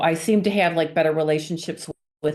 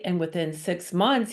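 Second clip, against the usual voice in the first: ordinary delivery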